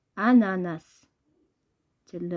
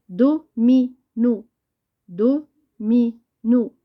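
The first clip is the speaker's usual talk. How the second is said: "Domino" split into do, mi, no with clear gaps; syllable by syllable; 2 semitones higher, 5 dB louder, much slower than usual. Shown as a demonstration. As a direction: say "Domino" slowly, pausing after each syllable. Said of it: "Entoure chaque syllabe". ("Domino" مقطع بمقطع، بوقفة بين المقاطع)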